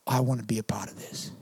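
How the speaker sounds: breathy hushed whisper of awe; awed newcomer whispering as they walk in. Retold or imitated. Non-Christians stepping into church.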